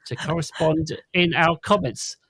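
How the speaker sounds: bright podcast outro announcer voice; announcer read. Acted. Host reading the podcast outro to listeners, announcer voice. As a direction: sell the outro like a radio announcer.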